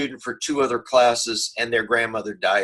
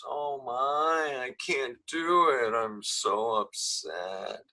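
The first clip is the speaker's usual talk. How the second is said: slow, softer mock whimper; mock whiner; 7 dB quieter, much slower than usual. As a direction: whimper it like a self-pitying student. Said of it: "the whiners".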